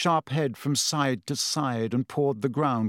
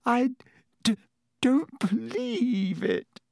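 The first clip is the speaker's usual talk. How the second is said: high, halting, horrified whisper; shocked whisper; 10 semitones higher than usual. A narrator's reading. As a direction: stammer it out slowly, aghast. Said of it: "she whispered to Harry".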